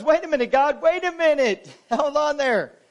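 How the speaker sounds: lightly voiced Moses begging him to stop; pleading Moses. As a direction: halt God, half-joking. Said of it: "And Moses goes".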